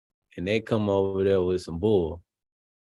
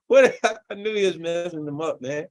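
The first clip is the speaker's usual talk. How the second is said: laughing; 8 semitones higher, 4 dB louder than usual. Real, not acted. Laughing reaction to clip.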